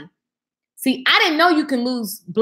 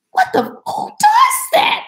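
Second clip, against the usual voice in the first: very high, slow, disbelieving squeal; incredulous; 3 dB louder, slower than usual. Real, not acted. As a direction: stretch it out in pure disbelief. Outraged at herself in hindsight.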